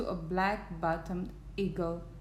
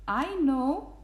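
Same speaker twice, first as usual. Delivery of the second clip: higher, lifted calling voice; 5 semitones higher, 4 dB louder than usual. A narrator's reading. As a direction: call up from a tiny town. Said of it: "called the voice".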